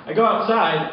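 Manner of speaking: ordinary delivery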